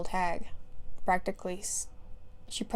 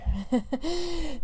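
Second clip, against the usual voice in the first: high burst of laughter; real giggle; 8 semitones higher than usual. Real, not acted. Reader breaking up during the story, giggling. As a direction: burst out giggling mid-line. Reader cracks up mid-line.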